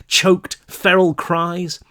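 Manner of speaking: usual speaking voice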